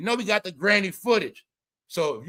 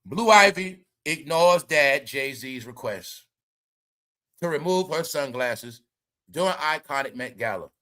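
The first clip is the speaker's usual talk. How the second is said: slow, deliberate news-anchor read; headline read; 5 semitones lower, slower than usual. Acted. Vlogger reads the gossip headline to viewers, mock news anchor. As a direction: announce it like a grave breaking story.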